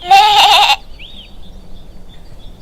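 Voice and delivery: very high loud cartoon giggle; high piggy giggle. A narrator's reading. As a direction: squeal a happy little laugh. Narrator giggles in story.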